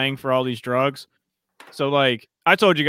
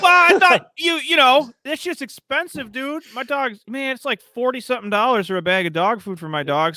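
indignant protest; 9 semitones higher than usual. Retold or imitated. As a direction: plead your case in a mock-aggrieved high voice. Retelling his indignant argument with dealer.

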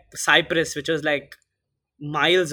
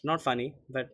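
ordinary talk, nothing marked

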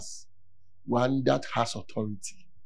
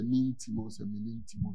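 faint mumble; 6 semitones lower, 9 dB quieter than usual. Retold or imitated. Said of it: "your friend say".

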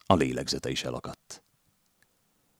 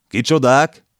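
very loud, much higher demand; barked question; 10 semitones higher, 10 dB louder, slower than usual. A narrator's reading. Detective demands again of the landlady, very loud. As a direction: snap the question back.

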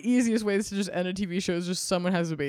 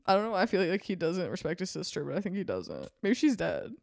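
ordinary talk, nothing marked

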